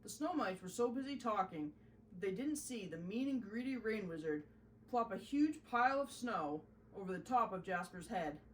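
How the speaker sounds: plain reading voice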